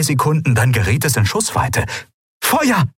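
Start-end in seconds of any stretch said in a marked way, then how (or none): 2.4-2.9 high-pitched shouted command; called out. A narrator's reading. Pilot orders the gunner to shoot, urgent call. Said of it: "rief sie".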